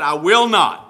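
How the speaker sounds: loud, emphatic no; defiant refusal. Acted. First son refusing his father's vineyard work, defiant refusal. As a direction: punch out the refusal loudly.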